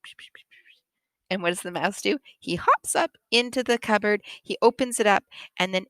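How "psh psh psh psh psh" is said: very soft, wordless whisper; hushed psh-psh whisper. Acted. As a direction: whisper secret nonsense to a tiny pet. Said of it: "whispered to the little".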